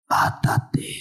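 sinister whisper. Acted. The inner voice at midnight tempting, whispered. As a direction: hiss it like a voice in the dark.

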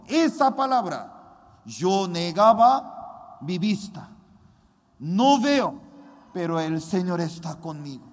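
high, loud fervent sermon; fervent preaching. Acted. Pastor testifying to his congregation, fervent preaching. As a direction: preach it with rising fervour.

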